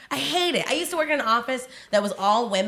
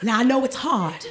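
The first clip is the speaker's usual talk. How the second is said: mock soothing mentor; 4 dB louder than usual. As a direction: play the wise, strong friend calming Crystal. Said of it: "And I was like".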